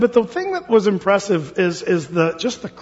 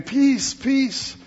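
lightly acted celebratory cry; celebrating crowd; 7 semitones higher than usual. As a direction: mimic people hailing peace in a raised pitch. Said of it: "people would be celebrating and saying".

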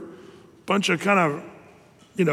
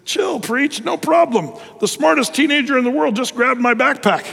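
put-on smug teenager; 7 semitones higher than usual. Smug teenager tells the pastor to chill, high and cocky. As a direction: crow at the pastor that he got it wrong. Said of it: "he said".

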